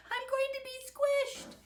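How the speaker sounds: high, loud panicked wail; alarmed call. A narrator's reading. Victor under raining peas, alarmed call. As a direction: cry out as if about to be flattened. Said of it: "he called".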